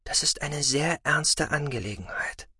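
soft, serious quiet voice. Acted. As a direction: gently stress the gravity. Mother speaking softly, seriously.

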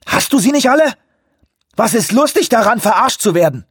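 laut, hoch, außer sich; wütendes Schreien. A narrator's reading. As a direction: jemanden empört anschreien. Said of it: "schrie Anawak". (wutentbrannt angeschrien)

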